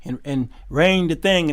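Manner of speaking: ordinary delivery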